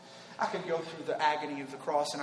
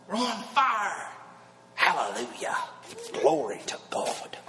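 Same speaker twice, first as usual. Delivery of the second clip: hammed-up preacher on a hallelujah; imitating a TV preacher, drawn out; slower than usual. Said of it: "I ought to talk in a preacher voice".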